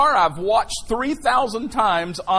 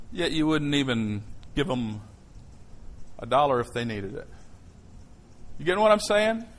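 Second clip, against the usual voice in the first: ordinary talk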